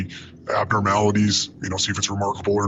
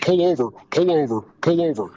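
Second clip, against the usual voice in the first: retold yell; 5 semitones higher than usual. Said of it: "I'm screaming out front".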